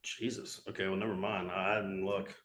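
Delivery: deflated. Real, not acted. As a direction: sag as if you just got caught out.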